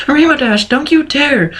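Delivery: high, panicky plea to her friend; a timid pony's high terrified plea; terrified whimper. A narrator's reading. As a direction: beg her not to, in a high frightened voice. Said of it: "whimpered Fluttershy in terror".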